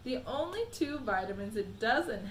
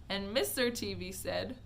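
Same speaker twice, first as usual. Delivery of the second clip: usual speaking voice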